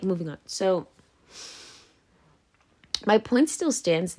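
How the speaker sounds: normal talking voice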